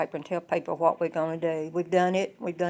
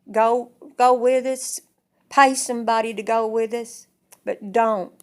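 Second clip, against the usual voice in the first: loud pleading; 3 semitones higher, 8 dB louder than usual. Retold or imitated. Daughter begging her father.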